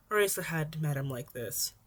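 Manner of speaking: usual speaking voice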